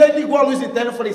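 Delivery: ordinary delivery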